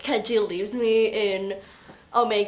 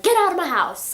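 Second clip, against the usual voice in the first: higher-pitched mock yell; acted angry outburst; 7 semitones higher, 4 dB louder than usual. Adam throws people out, in an acted angry outburst. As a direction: snap it out as a retold tantrum. Adam's temper tantrum retold.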